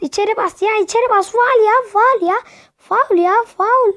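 high, loud cries of foul; furious gamer shouting foul; angry protest. Real, not acted. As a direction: yell it at the referee.

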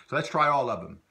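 rally the class to try; upbeat cue. Shown as a demonstration. Teacher cheerfully launches drill, upbeat. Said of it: "teacher cues drill".

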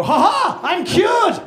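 high, loud triumphant cry; mock miracle cure. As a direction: announce a miracle.

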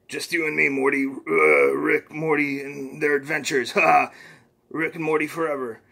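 lightly voiced boastful old scientist; Rick voice. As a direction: brag to Morty as a cocky grandpa. Rick figure in comic reading.

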